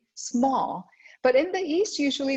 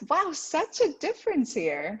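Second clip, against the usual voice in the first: pitched up, playing amazed at the difference; mock amazement; 6 semitones higher than usual. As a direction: act out a listener's wide-eyed wonder. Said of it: "and you wonder".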